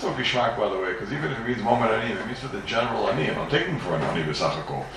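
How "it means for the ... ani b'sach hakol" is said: ordinary delivery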